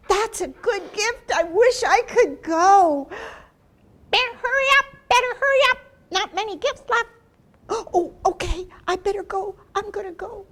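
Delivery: high, loud eager bird voice; Toucan. Acted. Toucan urging Turtle to hurry, high and loud. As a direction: chirp it out quick and eager.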